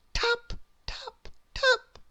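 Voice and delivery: high light tapping voice; tap tap. A narrator's reading. Small knock at the door, high light tapping.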